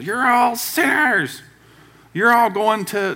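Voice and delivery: high, slow preacher's yell at sinners; street-corner evangelist. Retold or imitated. Street preacher scolding passersby, slow high mock yell. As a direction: condemn the crowd like a corner preacher. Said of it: "evangelist quote that sits on the corner and screams at people".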